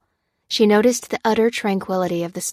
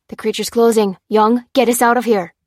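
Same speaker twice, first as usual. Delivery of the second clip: louder, higher, a sharp command; barked order; 3 semitones higher, 7 dB louder than usual. A narrator's reading. Lopez ordering Young to drive away, barked loud. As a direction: bark it like an officer. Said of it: "barked Lopez".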